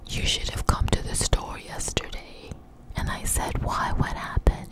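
ordinary delivery

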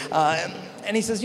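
ordinary delivery

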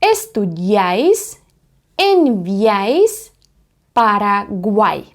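Estudiáis, enviáis, Paraguay slow and drawn out; triphthong examples. Shown as a demonstration. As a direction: model each word slowly. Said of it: "давайте подивимось на приклади".

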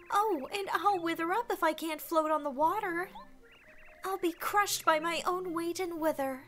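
high-pitched, melodramatic flower lamenting; distraught flower damsel. Acted. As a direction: wail theatrically as a helpless damsel. Voices melodramatic flower Lily.

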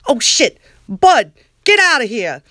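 sudden loud yelp at the dog; startled yell. Acted. Lauren shouting at the dog Bud to get out, loud. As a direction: bark it out, caught off guard. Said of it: "Lauren voice, startled at dog".

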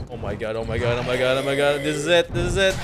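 loud, rising panic; scared horror game streamer. Real, not acted. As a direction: yell in mounting terror. Chased by monsters.

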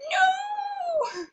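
high, drawn-out mock cry of dismay; retold wail. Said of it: "I was like".

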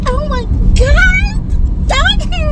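very high, slow mock gasp; 1 mock horror. Sister hamming up horror at the dog hair. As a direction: stretch it out in hammed-up dismay.

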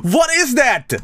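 high, slow stunned outburst; shocked. Real, not acted. Streamer stunned by a clip, high shocked yell. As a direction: shout it in disbelief.

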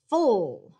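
"Full" stretched out, loud and falling; long "fool"-like "Full" with dark l; syllable sound FUL. Shown as a demonstration. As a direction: say "Full" as a bare word ending, not a word. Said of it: "It's not the word full".

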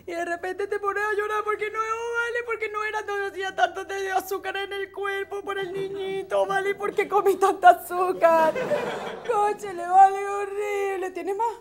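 high, whiny mock sob over sugar; mock crying. Pregnant woman cries over eating sugar, hammed-up whine. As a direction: wail with hammed-up guilt.

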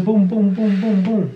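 loud playful pum pum chant, beat by beat; chanted heartbeat. Pretend. Partner chanting the baby's heartbeat for the camera, loud and playful. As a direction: thump it out like a heartbeat.

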